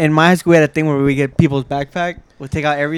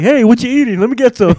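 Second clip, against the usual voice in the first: lightly voiced pushy roach, higher pitch; rude roach; 7 semitones higher, 4 dB louder than usual. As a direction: pipe up high as a cheeky insect cadging food. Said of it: "they're the rudest fucking insect ever".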